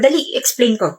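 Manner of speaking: ordinary talk, nothing marked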